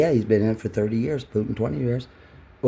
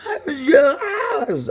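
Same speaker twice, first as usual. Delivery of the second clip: high, wailing mock sob; mock crying breakdown; 5 dB louder, slower than usual. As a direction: ham up a tearful meltdown. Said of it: "I went berserker".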